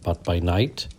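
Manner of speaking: ordinary delivery, nothing marked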